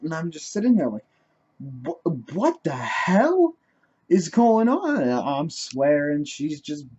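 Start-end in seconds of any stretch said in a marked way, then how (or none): 1.9-5.0 loud, high, drawn-out disbelief; bewildered outcry. Retold or imitated. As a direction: cry out in retold bewilderment. Being hit with a book.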